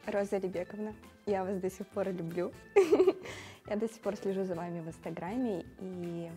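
ordinary delivery

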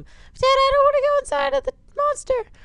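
put-on very high whining little boy; whiny kid voice. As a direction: beg like a scared small boy. Timmy whines to dad.

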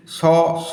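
স, স, each with a held final vowel; two long স syllables, a gap between; open syllable. Shown as a demonstration. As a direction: draw out each স to show it stays open. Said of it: "এটা হচ্ছে মুক্ত দল".

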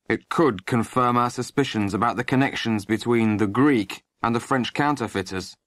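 ordinary delivery, nothing marked

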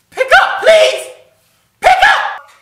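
very high, loud pleading squeal; squealing plea. Real, not acted. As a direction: beg her to pick up. Begging a caller to answer.

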